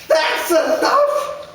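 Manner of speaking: mother cries out high and loud; crying out. A narrator's reading. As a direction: stop them with a sharp high cry. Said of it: "my mother cries out".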